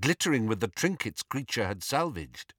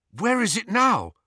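high, eager question; jubilant; 10 semitones higher than usual. A narrator's reading. Harry asking the elf where the locket is, jubilant. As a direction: leap on the answer with excitement. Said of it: "asked Harry jubilantly".

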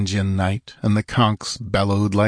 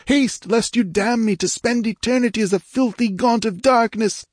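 urgent royal command; 13 semitones higher than usual. Acted. Dying king orders sister to strike, high and commanding. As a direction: demand she obey at once. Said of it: "old imperious tone".

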